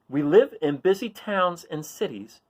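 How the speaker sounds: lively read-aloud voice for a picture book; reading a riddle book aloud. A narrator's reading. Reading a riddle page to children, playful read-aloud.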